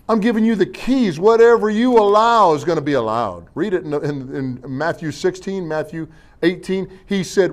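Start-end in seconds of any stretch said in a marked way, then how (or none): none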